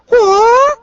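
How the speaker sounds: high, loud startled yelp; startled whoa. Acted. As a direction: cry out as you lose your balance. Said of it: "holds her arms out and goes".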